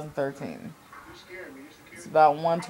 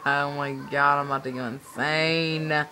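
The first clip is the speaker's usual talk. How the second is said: ordinary talk, nothing marked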